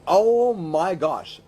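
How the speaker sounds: ordinary delivery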